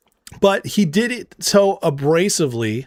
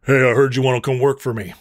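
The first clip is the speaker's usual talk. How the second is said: tough guy; 4 semitones lower, faster than usual. Retold or imitated. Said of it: "it'd be like".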